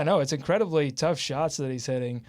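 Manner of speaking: usual speaking voice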